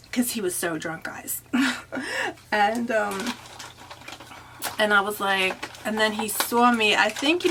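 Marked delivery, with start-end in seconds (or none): 1.5-2.5 quick high-pitched giggle; a short, high giggle; high laugh. Real, not acted. Laughs at the memory.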